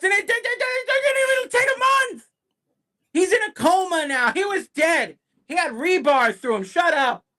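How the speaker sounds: fed-up yelling at a comic; angry rant. Real, not acted. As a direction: rant it out in disbelief. Ranting about the comic reversal.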